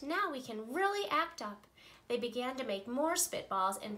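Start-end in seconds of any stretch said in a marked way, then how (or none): 0.0-1.7 a touch higher, eager and mischievous; lightly voiced excited children; gleeful kids. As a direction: sound like kids plotting mischief. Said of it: "yelled the kids".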